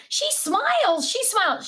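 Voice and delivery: high, slow quoted coo; high, delighted imitation of Ben; quoting Ben. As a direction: coo it like a proud kid. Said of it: "Ben was saying".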